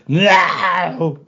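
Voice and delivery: high, loud, drawn-out imitation of a refusal; mock defiant shout. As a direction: yell the refusal as a hammed-up impression. Acted refusal at the microphone.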